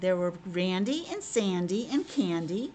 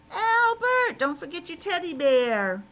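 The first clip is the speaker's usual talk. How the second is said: high, louder singsong taunt at Albert; mocking taunt; 7 semitones higher, 6 dB louder, slower than usual. Siblings taunting the youngest piglet at bedtime, mocking singsong. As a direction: tease him like a big sibling.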